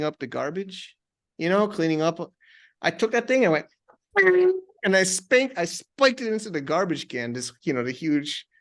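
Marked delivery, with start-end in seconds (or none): 4.2-4.6 sound effect bing. Retold or imitated. Spiking the bell into garbage.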